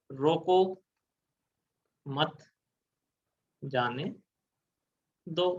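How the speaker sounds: long pause after each word: "रोको ... मत ... जाने ... दो". Shown as a demonstration. Teacher lays out four words of a sentence, word by word: "रोको ... मत ... जाने ... दो". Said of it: "ये चार शब्द हैं".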